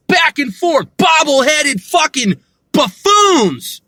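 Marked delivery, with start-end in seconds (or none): none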